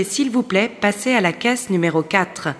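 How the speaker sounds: usual speaking voice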